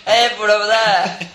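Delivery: loud call to a crowd; shouting out. Retold or imitated. Acting out the street performer.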